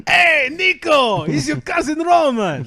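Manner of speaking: put-on high, slow shouted Russian-accented greeting. Cousin Roman greets Nico with a loud Russian-accented shout. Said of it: "you want Boris to give you a Russian accent".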